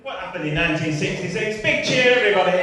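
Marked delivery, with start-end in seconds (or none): 1.6-2.6 loud call to the crowd; rallying shout to the room. Real, not acted. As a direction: whip the audience up into a cheer. Calling on audience to cheer.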